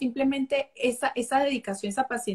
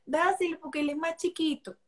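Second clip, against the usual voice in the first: a higher, lightly put-on scolding mother; mock scolding mom; 5 semitones higher than usual. A mother wrongly telling her older son, a light mock scold. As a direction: mimic the unfair mother's order. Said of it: "yo no le voy a decir".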